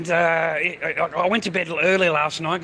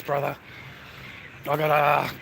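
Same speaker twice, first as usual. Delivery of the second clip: ordinary delivery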